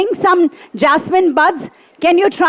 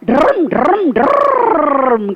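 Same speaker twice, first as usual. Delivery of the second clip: revving trill with a long last roll; auto engine sputter; much slower than usual. Retold or imitated. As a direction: imitate a rickshaw motor starting. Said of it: "she also goes like".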